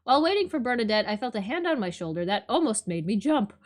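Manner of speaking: ordinary talk, nothing marked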